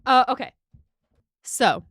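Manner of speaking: normal talking voice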